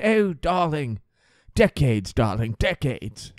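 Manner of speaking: put-on British accent, savouring each word; British old lady. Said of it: "She goes".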